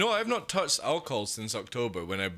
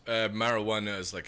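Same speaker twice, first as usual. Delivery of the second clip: ordinary delivery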